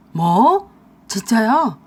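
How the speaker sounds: loud, stunned disbelief; shocked. Acted. Husband hearing the videos spread, loud and stunned. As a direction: gasp it out in disbelief.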